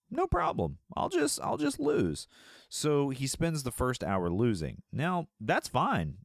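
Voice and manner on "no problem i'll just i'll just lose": high, easygoing mock voice; carefree gambler voice; voiced Maverick. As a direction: toss it off lightly. Mel Gibson quote, high pitched.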